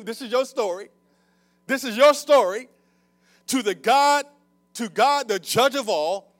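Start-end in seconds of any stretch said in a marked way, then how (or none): 1.7-2.7 raised, loud preaching call; emphatic repeat. Real, not acted. Preacher telling the church it is theirs, emphatically. 3.5-6.3 loud, raised, weighty verse reading; proclaiming scripture. Real, not acted. Preacher reading Hebrews to the church, proclaiming it.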